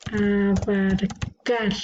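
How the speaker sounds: "Abarcar" very slowly, a-bar, then a held CAR; final syllable stressed. Shown as a demonstration. Teacher sorting an aguda word, slow "Abarcar" with weighted CAR. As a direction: stretch "Abarcar" and land on CAR. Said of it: "esta es una palabra aguda".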